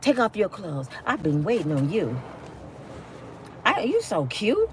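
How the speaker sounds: a hammed-up sultry invitation; fake seductive. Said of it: "play along with that game".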